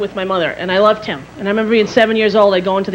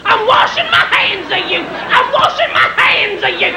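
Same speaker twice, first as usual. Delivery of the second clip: screaming doctor; 9 semitones higher than usual. Retold or imitated. Angry doctor screaming at a patient who wants to leave, high mock scream. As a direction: yell it over and over, higher each time. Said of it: "He's screaming".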